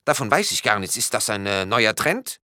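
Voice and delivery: high-pitched questioning voice; puzzled policeman. A narrator's reading. Policeman's voice in story.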